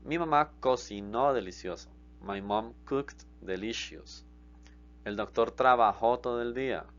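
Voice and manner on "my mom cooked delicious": slow, deliberate English; slow model sentence. Shown as a demonstration. Teacher modelling English translation slowly for students. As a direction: say each word slowly for learners. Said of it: "teacher models English translation".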